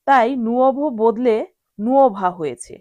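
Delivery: ordinary talk, nothing marked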